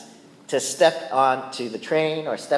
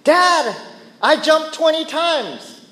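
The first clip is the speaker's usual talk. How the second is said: put-on much higher, louder excited child voice; excited kid; 13 semitones higher, 6 dB louder, slower than usual. Father imitating his son bragging about jumps, excited and high. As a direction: cheer like a proud little boy. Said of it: "Isaiah will come up to me and say".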